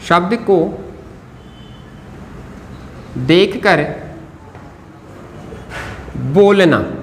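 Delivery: each word set apart by long pauses; writing-pace dictation. Real, not acted. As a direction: say it piece by piece while writing. Writes the phrase while saying it.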